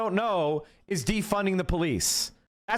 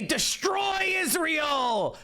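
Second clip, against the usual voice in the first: slow, higher, drawn-out mock shout; mock yell; 7 semitones higher, much slower than usual. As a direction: bellow it like a cartoon hardliner. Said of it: "he's not saying it meanly enough".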